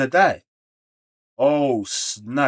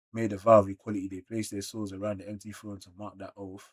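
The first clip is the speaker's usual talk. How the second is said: quiet dramatic reading; 6 dB quieter than usual. Acted.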